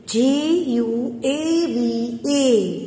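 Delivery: letters of guava spelled very slowly; slow spelling. Shown as a demonstration. Teacher spelling guava letter by letter for her pupils. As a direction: lay out each letter for young writers. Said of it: "spelling letters out".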